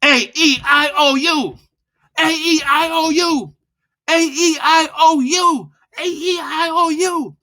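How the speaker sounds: high, loud vowel drill; loud, high, charged vowels; loud and fast. Shown as a demonstration. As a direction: punch each vowel out with force. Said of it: "loud and fast".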